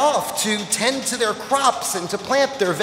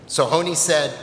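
ordinary delivery

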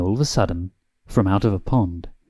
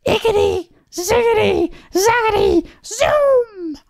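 very high incantation, each word set apart; witch casting a spell. A narrator's reading. Witch stirring the cauldron, high-pitched spell. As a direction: chant it high like magic words. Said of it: "she muttered a spell".

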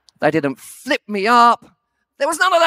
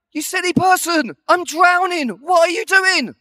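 higher, louder mock outburst; retold complaint, pitched up in disbelief; exasperated protest; 9 semitones higher, 3 dB louder than usual. Drowning dad to filming wife, exasperated. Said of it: "I'm like".

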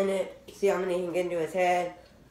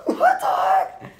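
slow, squeaky laughing "what the heck"; laughing disbelief; 5 dB louder, much slower than usual. Real, not acted.

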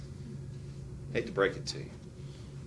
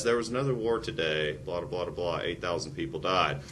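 bored mock report; 7 semitones lower, 6 dB quieter than usual. Mocking bland war report.

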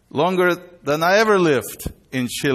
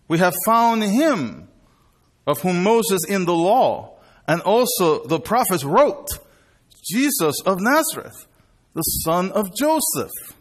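excited wonder; 6 semitones higher than usual. Acted. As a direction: burst with the joy of discovery. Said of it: "receive the news with wonder".

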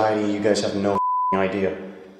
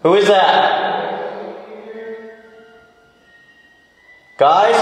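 frightened call; 11 semitones higher, slower than usual. Real, not acted. Calling friends after a noise, high frightened call. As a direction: call out high to whoever is there.

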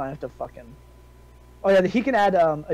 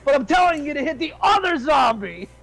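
high, raised voice ordering a zombie about; calling out orders; 10 semitones higher, 4 dB louder than usual. Acted. Player's fighter commanding a zombie, calling out high. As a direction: shout a rambling command, pitched way up. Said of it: "point at, this zombie right here and say".

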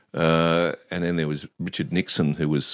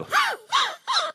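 high wheezing engine noise; imitated car starting. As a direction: mimic an old car straining to start. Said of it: "the Volkswagens would make such an odd sound".

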